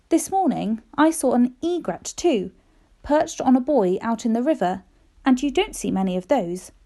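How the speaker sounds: ordinary delivery, nothing marked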